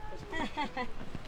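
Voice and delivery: bright burst of laughter; laughing. Real, not acted. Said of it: "laugh echoes down the trail".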